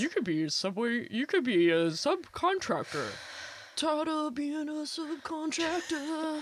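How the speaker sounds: hammed-up rock anthem voice, rising high; mock ad jingle. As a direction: belt it like a cheesy commercial anthem. Mock Subway ad song.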